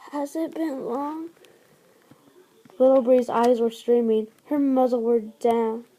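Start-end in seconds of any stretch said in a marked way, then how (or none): 0.1-1.3 fading, weak question from a sickbed; barely voiced, dying queen; a frail, feeble ask; faint sick voice. Sick Willow Breeze asks weakly.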